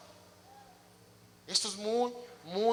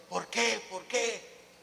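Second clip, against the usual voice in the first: softer, mock-tearful pleading; imitated weeping; 2 semitones higher, 5 dB quieter, much slower than usual. Preacher retelling the millionaire's tearful question, lightly imitated weeping. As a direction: plead like someone crying, lightly acted. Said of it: "llore y llore, diciendo".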